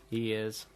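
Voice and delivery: drawn-out "Ears" for a posh yes; posh English yes. Shown as a demonstration. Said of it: "sound like a very posh English yes".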